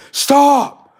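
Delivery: high, loud, sudden "Stop!"; a hammed-up shout of panic; mock yell. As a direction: yell it to cut him off. Imitated listeners cutting him off.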